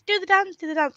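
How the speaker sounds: high, eager urging; high-pitched excited imitation; mock egging-on. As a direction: pester a friend to dance. Imitates friends egging her on.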